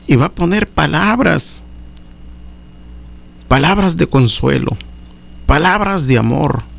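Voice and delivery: high, rising preacher's exhortation; fervent preaching. Acted. Preacher urging the congregation, fervent high preaching. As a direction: preach it out to the brothers with fire.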